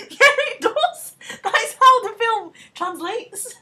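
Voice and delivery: high, loud laughing insistence. Real, not acted. Insisting to her friend while laughing hard. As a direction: squeal it through laughter, slowly.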